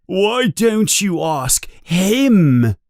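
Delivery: high, drawn-out accusation; angry yell. A narrator's reading. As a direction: throw the question out accusingly. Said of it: "he yells and points at Harry".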